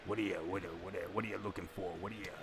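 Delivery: a light hint of a crooning old radio DJ; old-timey radio host. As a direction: coax the listener like a fifties DJ, lightly. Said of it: "Old-timey radio".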